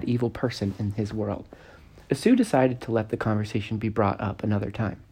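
plain reading voice

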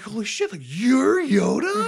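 very high, loud, drawn-out mock disbelief; astonished realization. Luke realizing the green guy is Yoda, astonished. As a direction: squeal the stunned question.

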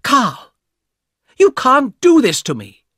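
high, loud furious shout at her brother; angry shout. Acted. Young woman shouting angrily at her brother Carl. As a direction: yell it at him, outraged. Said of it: "shouted at the man".

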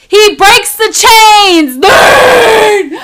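high, loud, slow triumphant shout; fiery preaching shout. Real, not acted. Vlogger preaching God's freedom, loud high triumphant shout. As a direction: proclaim it like a rally cry. Preaching climax, God breaks chains.

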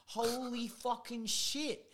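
slow, high, drawn-out amazement; re-enacted amazement, high and slow; stunned reaction. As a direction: stretch every word in stunned disbelief. Acts out his amazed reaction.

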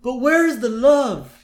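high, slow wistful Apu voice; lilting shopkeeper sigh, slowing down; imitating Apu. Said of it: "Abu's kinda like".

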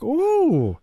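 high, loud drawn-out ooh; mock-impressed grandpa. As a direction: coo in feigned amazement at the threat. Acts grandpa's reaction to threat.

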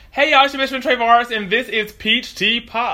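bright, loud show opener; podcast intro. Acted. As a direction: announce the show with hype. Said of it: "All right, hold up".

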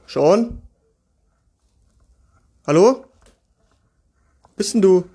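loud rising call into the room. Real, not acted. Calling for hidden child.

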